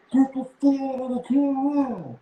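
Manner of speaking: high, slow megaphone cop voice; cop on loudspeaker. Retold or imitated. Mimicking police calling out the group of four. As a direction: bark it like a cop through a speaker.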